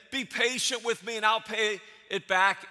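the servant begs his master for patience, pleading; begging servant. Acted. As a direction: beg like a servant on his knees.

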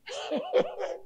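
high burst of laughter; big laugh. Real, not acted. Laughs after the story.

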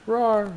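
high-pitched mock monster rawr; playful roar. Playful noise after card name.

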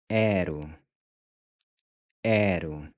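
"Ero" with an E like in air, then a short U; open E. Shown as a demonstration. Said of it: "The E is pronounced sort of like the E in air". ("Ero" with an E like in air)

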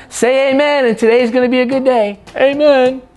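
high, fast, rousing amen call; preaching amen call. Real, not acted. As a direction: lift the room into the amen. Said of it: "preacher's closing call".

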